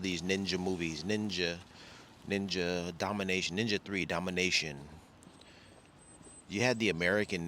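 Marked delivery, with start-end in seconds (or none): none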